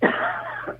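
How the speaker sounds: sharp choking cough. Real, not acted. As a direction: cough as a drink goes down wrong. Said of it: "Ay, me atraganté".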